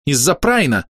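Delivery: name the real culprit with some indignation; indignant. A narrator's reading. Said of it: "возмущённо сказала Хейли".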